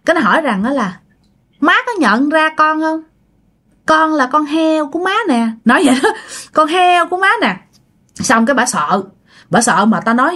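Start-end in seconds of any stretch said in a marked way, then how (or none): 1.6-5.5 higher, teasing pig-woman voice; pig-spirit daughter-in-law. Acted. Pig spirit reveals itself to mother-in-law. 6.5-7.6 higher, teasing pig-woman voice; pig-spirit daughter-in-law. Acted. Pig spirit repeats its reveal.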